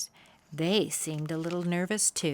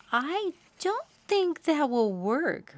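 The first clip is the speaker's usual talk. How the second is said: doubtful robin; 10 semitones higher, 3 dB louder, slower than usual. A narrator's reading.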